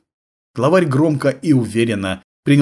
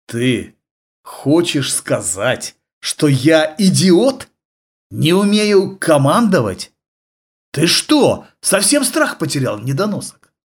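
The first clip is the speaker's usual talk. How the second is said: slow, rising menace; menacing; 5 semitones higher, slower than usual. Acted. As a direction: lean in and threaten slowly. Boss threatens his henchman.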